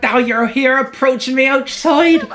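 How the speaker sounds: high, excited gushing; overwhelmed fangirl character. Acted. Fangirl approached outside by her roommate, gushing giddily. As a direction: build to a giddy, high squeal.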